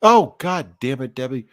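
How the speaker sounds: ordinary talk, nothing marked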